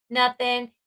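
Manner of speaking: normal talking voice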